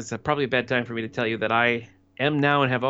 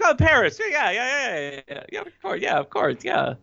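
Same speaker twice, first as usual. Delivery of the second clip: much higher, overeager babbling yeahs; eager playing along; 12 semitones higher than usual. Nat hurriedly backing up Brenda's lie to Rick, overeager. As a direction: gush agreement like you are covering for a friend.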